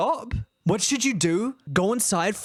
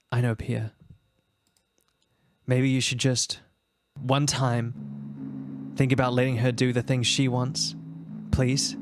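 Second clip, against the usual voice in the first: gently pleading wife; 8 semitones lower than usual. Acted. Wife asks husband to let daughter be, low pleading. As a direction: plead gently, with careful pauses.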